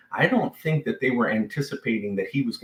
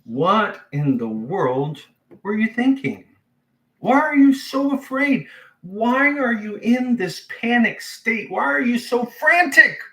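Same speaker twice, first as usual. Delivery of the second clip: exasperated questioning; 13 semitones higher, 4 dB louder than usual. Retold or imitated. Jesus asking frightened disciples, high exasperated rebuke. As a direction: demand why they panicked. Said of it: "he says this".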